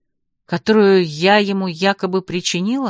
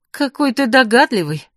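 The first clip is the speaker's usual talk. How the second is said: higher-pitched, scornful little exclamation; snorting retort; 5 semitones higher, 3 dB louder than usual. A narrator's reading. Heroine retorts to the mage about her torn dress, snorting. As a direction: toss it off with a snort in the voice. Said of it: "фыркнула я".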